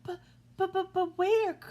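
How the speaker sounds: high, stammering little goat voice; nervous middle goat. A narrator's reading. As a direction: stutter it out high and worried. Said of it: "asked the middle goat".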